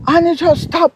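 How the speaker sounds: ordinary talk, nothing marked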